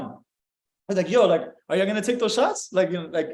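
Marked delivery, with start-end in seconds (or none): 1.1-2.7 playful question with a rising end; fun, joking tone. Shown as a demonstration. Chatting up a girl about shots, fun and playful. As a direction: toss it out like you're having fun. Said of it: "in that tone of voice".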